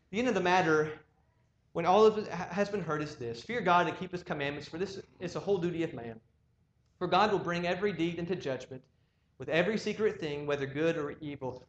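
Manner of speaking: loud, solemn scripture reading. Acted. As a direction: proclaim the verse with weight. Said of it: "he says in verse thirteen".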